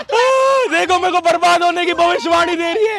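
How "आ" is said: high mock-crying wail; put-on wail. Boy told he will be ruined, hammed-up wail. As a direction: burst into a theatrical high bawl. Boy wailing at being cursed.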